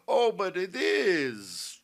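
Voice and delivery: loud, drawn-out gloating chortle; chortling. A narrator's reading. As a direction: laugh first, then crow it slowly. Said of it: "chortled the general".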